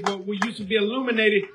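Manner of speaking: ordinary delivery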